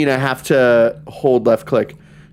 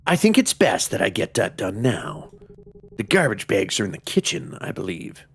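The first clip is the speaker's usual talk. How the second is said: lightly voiced game narrator; 2 semitones lower, 6 dB quieter than usual. Home-alone kid thinking about chores, understated reading voice. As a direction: read the inner monologue quietly, with pauses. Reads game character's thoughts.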